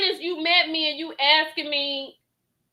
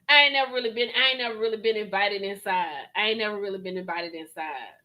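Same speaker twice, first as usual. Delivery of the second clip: mock sheepish guest; 6 semitones lower, faster than usual. Reactor mimics the guest repeating his excuse, lower and hushed. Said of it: "repeats the guest's line".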